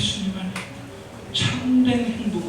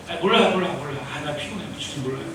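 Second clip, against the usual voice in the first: evasive, tired brush-off; 7 semitones lower, 4 dB quieter, faster than usual. Acted. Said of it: "대답 안 하려고".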